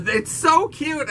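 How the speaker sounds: high-pitched squeal of adoration; real, overflowing cuteness overload; gushing delight. Real, not acted. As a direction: coo excitedly over something adorable. Gushing over cats named after breakfast.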